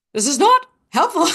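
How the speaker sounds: high, loud inner protest; retold exasperation. Overwhelmed speaker reacting to a man's advice, exasperated. Acts out frustration at unhelpful colleague.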